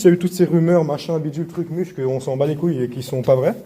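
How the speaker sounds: usual speaking voice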